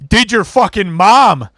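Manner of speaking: drawn-out imitation of a yelling heckler; imitated heckler scream. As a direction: holler it out like a drunk bro. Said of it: "and he screams out".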